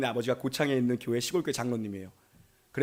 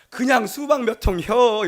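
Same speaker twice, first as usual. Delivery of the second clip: high, loud, slow Jeolla granny voice; drawn-out Jeolla dialect, loud and high like a grandmother; Jeolla dialect old lady; 8 semitones higher, 9 dB louder, slower than usual. Retold or imitated. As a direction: play an elderly countrywoman in thick Jeolla dialect. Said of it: "여기도 사투리인데 내가 흉내는 못 내겠는데".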